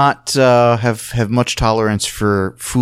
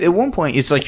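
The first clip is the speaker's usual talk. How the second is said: ordinary delivery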